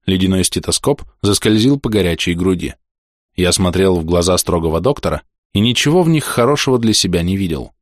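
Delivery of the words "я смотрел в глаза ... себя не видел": plain reading voice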